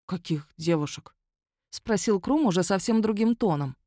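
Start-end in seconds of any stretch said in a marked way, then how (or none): 0.1-1.2 softer, lost tone; suddenly thrown and unsure; bewildered. A narrator's reading. Werewolf to the magistrate, suddenly bewildered. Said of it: "другим тоном, каким-то растерянным".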